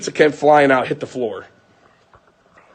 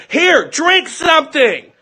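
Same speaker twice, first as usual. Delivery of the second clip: high, drawn-out mock yell; imitated yelling; 12 semitones higher, slower than usual. Mother yelling at choking son, as retold, imitated yelling. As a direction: mimic a frantic yell, pitched way up. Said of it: "she's yelling at him".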